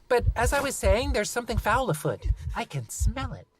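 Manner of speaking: put-on high bossy kid; child voice. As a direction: play a bossy little leader. Lucy child voice.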